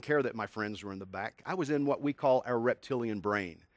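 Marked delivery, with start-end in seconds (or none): none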